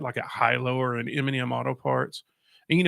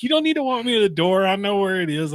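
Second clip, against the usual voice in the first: higher, louder indignant protest; mock-offended, raised voice; indignant retort; 7 semitones higher, 6 dB louder than usual. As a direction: push back at the manager, offended. Retold being walked out indignantly.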